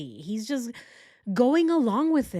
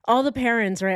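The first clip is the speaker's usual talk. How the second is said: usual speaking voice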